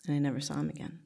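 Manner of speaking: normal talking voice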